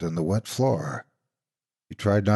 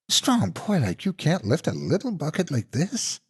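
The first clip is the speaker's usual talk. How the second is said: higher, wheedling monster-aunt voice; mocking fake auntie; 8 semitones higher than usual. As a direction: tease him with false sweetness. Monster taunts the boy.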